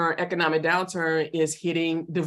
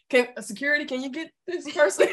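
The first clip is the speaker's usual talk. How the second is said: mock alarmed celebrity; 8 semitones higher than usual. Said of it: "It's like".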